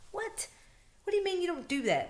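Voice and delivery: high-pitched retold disbelief; incredulous. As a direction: act out being baffled by a refusal. Acted disbelief at police refusal.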